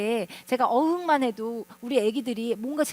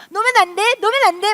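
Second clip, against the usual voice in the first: loud, high repeated cry, imitating a kid; child calling out; 8 semitones higher, 10 dB louder, faster than usual. Child riding away, still calling to mom who let go.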